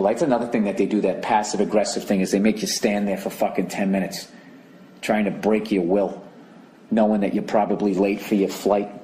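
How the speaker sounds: usual speaking voice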